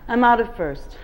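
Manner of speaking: usual speaking voice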